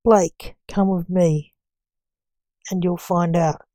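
plain reading voice

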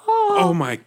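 high, loud horrified cry; shocked gasp. Acted. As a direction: react in total shock. Everyone just got killed.

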